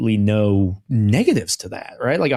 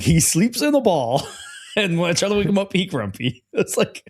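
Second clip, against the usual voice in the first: giggling through the words, pitch jumping up; can't stop laughing while retelling it; 7 semitones higher than usual. Real, not acted.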